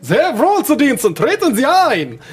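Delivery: high, slow, servile welcome; bow them in like an eerie servant; creepy butler. Acted. Juri bows guests in.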